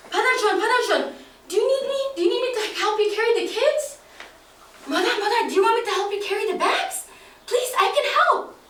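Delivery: put-on high, fast little-kid voice; keen to help, offering again and again; boy asking elders if he can carry things; eager young boy.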